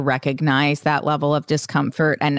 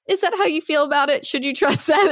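much higher, questioning inner voice; second-guess yourself in a high voice; doubting inner voice; 10 semitones higher than usual. Pretend. Describing inner dialogue to a co-host, high skeptical voice. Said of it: "internal conversation".

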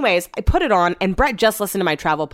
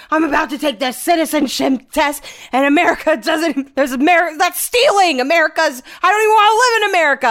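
high, loud whiny rant about America; mock dramatic husband; 10 semitones higher, 5 dB louder than usual. Wife mocking husband's overdramatic rant, high and whiny. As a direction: ham up his dramatic outrage. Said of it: "just being so fucking dramatic".

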